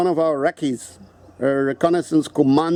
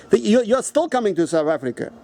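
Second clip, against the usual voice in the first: ordinary talk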